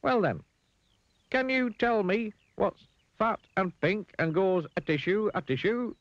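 very high, deliberate riddle voice; Mister Dizzy. A narrator's reading. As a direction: pose the riddle slowly in a piping voice. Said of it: "smiled Mister Dizzy".

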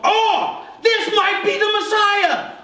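high, loud excited gasp of hope; hopeful crowd. Retold or imitated. Crowd on the mountain spotting a would-be Messiah, high excited shout. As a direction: cry out like a crowd sure the Messiah is here. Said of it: "so that people would go".

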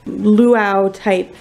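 normal talking voice

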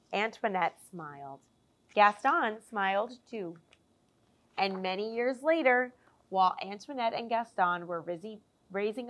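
lively picture-book storytelling voice; animated children's story narration; reading to children. A narrator's reading. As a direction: read it like story time for little ones. Reads picture book aloud.

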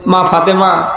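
ordinary talk

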